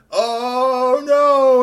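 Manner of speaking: high, loud wail of oh no; cry of alarm. Acted. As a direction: shriek it as he drops. Character falling.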